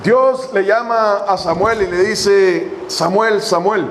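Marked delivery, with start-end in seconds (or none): none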